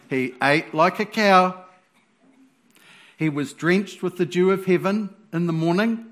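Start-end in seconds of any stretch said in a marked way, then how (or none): none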